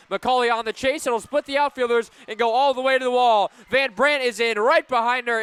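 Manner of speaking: radio announcer calling a big hit, high and loud; excited play-by-play. Real, not acted. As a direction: call the triple with building thrill. Live call of a two-run triple.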